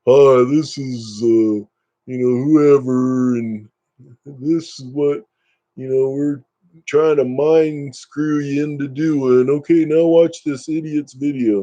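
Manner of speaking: slow, drawn-out put-on presenter voice; mock ad pitchman. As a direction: parody a smug ad host, dragging the words. Acts out a schmuck in an ad.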